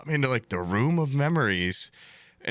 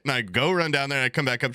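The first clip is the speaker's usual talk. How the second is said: ordinary talk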